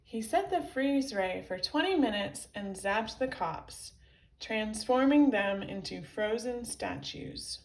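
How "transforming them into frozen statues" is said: ordinary delivery, nothing marked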